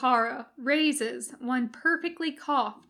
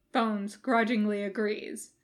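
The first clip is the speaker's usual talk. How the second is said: usual speaking voice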